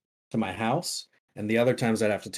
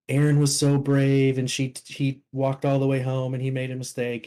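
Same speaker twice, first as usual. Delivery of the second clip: proud mom bragging; 4 semitones higher, 4 dB louder than usual. Retold or imitated. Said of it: "she was like".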